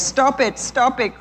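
little birds crying out to the soldiers. A narrator's reading. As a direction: beg them to stop, small and desperate. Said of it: "cried the little birds".

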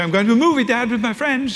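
impatient to get going; teenage daughter. Retold or imitated.

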